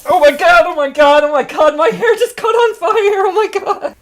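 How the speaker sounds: high, loud panicked shrieks; shrieking jackpot winner. Pretend. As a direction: shriek like your hair caught fire. Hair on fire freakout.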